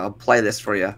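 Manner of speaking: ordinary delivery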